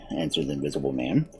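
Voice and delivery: usual speaking voice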